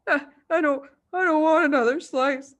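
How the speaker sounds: put-on a scared boy protesting; nervous schoolboy refusing; frightened boy. A narrator's reading. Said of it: "the boy said".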